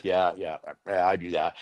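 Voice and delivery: low, soft, noncommittal yeahs; feigned agreement. Retold or imitated. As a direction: imitate humoring a therapist, voice dropped low. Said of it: "how to do the therapeutic nod".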